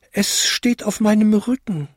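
high small bell voice; tinkling little bell. A narrator's reading. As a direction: chime it high like a tiny bell. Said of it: "bimmelte die kleinste Glocke". (hoch bimmelndes Glöckchen)

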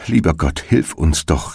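ordinary delivery, nothing marked

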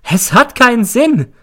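high, loud shriek; a hysterical mother shrieking. A narrator's reading. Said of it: "Dann kreischt sie".

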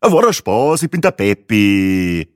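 loud joker, slowing to a drawl; Peppi, jovial bus clown. A narrator's reading. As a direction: call it out, then stretch the name. Bus clown introduces himself jokingly.

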